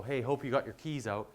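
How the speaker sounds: ordinary delivery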